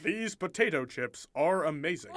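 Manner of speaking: exaggerated American suburban dad; imitated American accent. Mimicking a foreigner imitating Americans, overdone American accent. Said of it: "doing an impression of an American".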